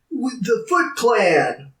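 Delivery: high, drawn-out put-on voice; mock reporter. April O'Neil naming the culprits, slow mock-dramatic voice. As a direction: imitate a dramatic revelation, slowly.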